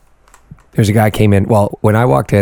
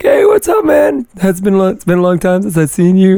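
excited greeting; 11 semitones higher than usual. Retold or imitated. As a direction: greet an old friend with a shout. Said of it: "responded being like".

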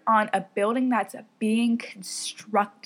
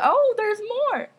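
high, drawn-out mock realization; mock surprise; 13 semitones higher, 6 dB louder, slower than usual. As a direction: sing it out loudly, as if discovering more. Jokingly announces yet more phobias.

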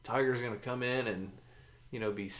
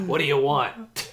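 slow rough grumpy waitress; smoker's rasp; 3 semitones higher, 8 dB louder than usual. Pretend. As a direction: bark it like a tired hag. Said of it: "old hag ... with a smoker's voice".

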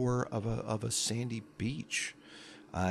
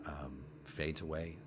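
ordinary talk, nothing marked

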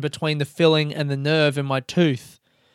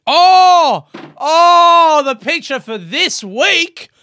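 very high, loud excited cry; high shouting announcement, stretched out; 13 semitones higher, 9 dB louder, much slower than usual. Real, not acted. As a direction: burst out like a game-show host.

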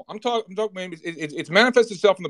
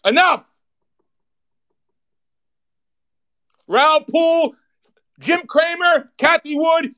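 much higher and louder, shouting in real anger; furious rant; 8 semitones higher, 5 dB louder than usual. Real, not acted. Host raging at executives and lawyers, shouting angrily. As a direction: let it explode, yelling at the crooks.

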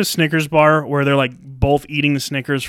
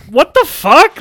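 mock shock; 11 semitones higher, 5 dB louder, slower than usual. Acted reaction to car ad.

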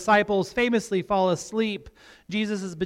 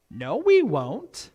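put-on slow, singsong child's denial with a big pitch swoop; fake-innocent kids; slower than usual. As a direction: deny it sweetly like scheming little boys. Voices sons feigning innocence.

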